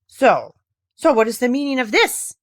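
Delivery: loud, gruff demanding question; gruff old man. A narrator's reading. Grandfather gruffly greeting the unexpected child. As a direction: bark it at the child. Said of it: "he asked gruffly".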